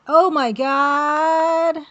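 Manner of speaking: loud, high, drawn-out cry of delight; thrilled outburst. Real, not acted. Streamer learns the fix from chat, thrilled outburst. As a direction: draw it right out at full volume, elated. Thrilled reaction to chat's solution.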